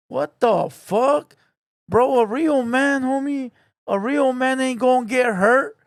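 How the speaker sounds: ordinary talk, nothing marked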